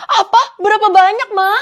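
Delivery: loud, high-pitched disbelief; startled, high and loud questioning; shocked outcry. Acted. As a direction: cry out in alarm at shocking news. Child shocked at mother's robbery news.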